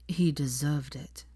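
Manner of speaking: slow, cold, level verdict. A narrator's reading. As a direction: deliver it icily. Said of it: "said Hermione coldly".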